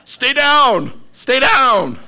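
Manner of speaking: high, loud jeering call; ringside yell. Retold or imitated. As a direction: shout him down to the mat. Bettor on Apollo yells at Rocky.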